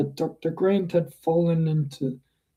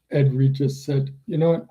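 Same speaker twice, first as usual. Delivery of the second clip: plain reading voice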